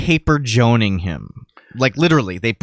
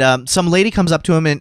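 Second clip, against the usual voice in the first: normal talking voice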